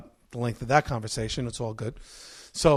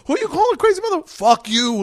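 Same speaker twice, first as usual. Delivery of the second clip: much higher, louder snapback; mock angry retort; 12 semitones higher, 12 dB louder than usual. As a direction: fire back an insult. Heated insult exchange.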